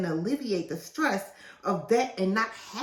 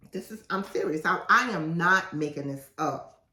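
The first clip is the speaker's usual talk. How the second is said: ordinary delivery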